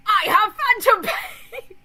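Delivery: high, loud, slow laughing shriek; hammed-up shrieking wail, breaking into laughs; overdramatic phantom pain wail. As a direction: wail it through laughter like a melodramatic gamer. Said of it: "the VR chat people who are like".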